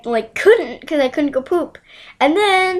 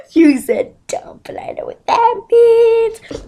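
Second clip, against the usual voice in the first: high, slow, squeaky giggle-talk; mock a kid cracking up at a rude word; hammed-up breathless, squealing amusement; over-the-top giggling classmate; 11 semitones higher, 5 dB louder, slower than usual. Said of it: "he's, like, over the top".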